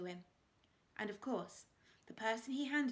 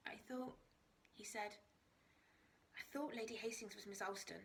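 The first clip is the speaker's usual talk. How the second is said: much softer, subdued admission; quiet confession; 7 dB quieter than usual. A narrator's reading. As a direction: murmur it, ashamed. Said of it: "Stephen shook his head".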